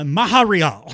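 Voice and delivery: loud mangled "Maharial" with breathy h for the t; botched Montreal. Retold or imitated. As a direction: belt out "Maharial" as a bad French attempt. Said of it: "somewhere in the Middle East".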